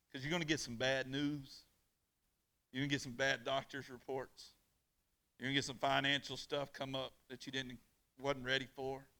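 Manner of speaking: ordinary delivery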